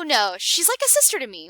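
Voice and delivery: plain reading voice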